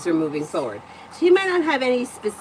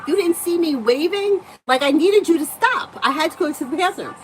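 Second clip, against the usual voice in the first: higher and louder, mock-outraged complaint; exasperated yelling; 8 semitones higher, 5 dB louder than usual. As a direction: replay the fight, voice pitched up in frustration. Retold argument with husband over waving.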